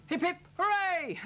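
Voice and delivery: high, loud cheer of hip hip hooray; cheering shout. Retold or imitated. Farm friends celebrating the happy ending, loud cheer. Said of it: "they all shouted".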